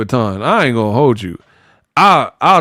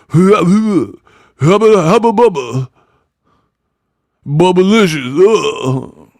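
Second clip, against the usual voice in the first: mock garbled boasting that slides into babble; hammed-up, grunting impression of an incoherent boxer; Clarissa Shields. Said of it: "she out here talking like that".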